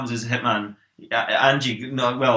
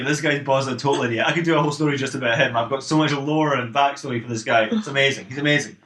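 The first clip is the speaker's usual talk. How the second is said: ordinary talk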